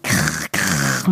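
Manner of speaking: low rolling raven caw; raven's croak. A narrator's reading. Raven squeezed on the head by Bürle, croaking. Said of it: "dass er krächzte".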